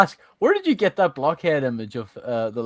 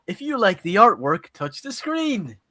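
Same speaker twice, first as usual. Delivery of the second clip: Dora TV host voice; 4 semitones higher than usual. Retold or imitated. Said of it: "it's like those Dora T V shows".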